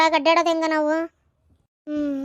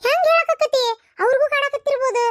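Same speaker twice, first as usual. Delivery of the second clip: alarmed; 10 semitones higher, 4 dB louder than usual. Acted. Spooked villager on ghost talk.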